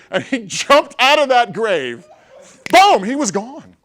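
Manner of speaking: high, very loud, slow punched-out storytelling; shouted climax. Real, not acted. Speaker telling how the man fled the grave, shouted climax. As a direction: belt out each phrase for comic payoff.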